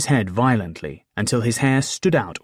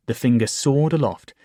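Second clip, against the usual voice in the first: plain reading voice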